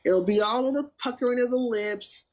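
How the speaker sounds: ordinary delivery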